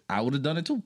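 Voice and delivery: usual speaking voice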